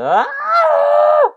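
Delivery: piercing high shriek; scream. Real, not acted. Piggy nearly falling off, piercing scream. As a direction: yelp in sudden panic.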